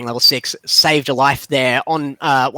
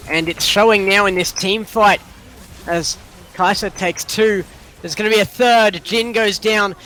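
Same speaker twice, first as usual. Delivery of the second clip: higher-pitched, excited esports commentary; live play-by-play; 7 semitones higher than usual. Real, not acted. Caster calling kills in a team fight, live play-by-play. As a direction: call the team fight as it happens.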